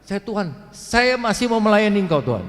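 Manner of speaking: high, loud cry to God; re-enacted shout, pitched high and forceful; shouted plea. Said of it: "Saya teriaknya begitu".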